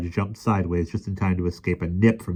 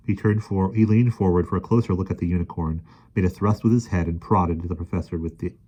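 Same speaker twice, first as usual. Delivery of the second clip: usual speaking voice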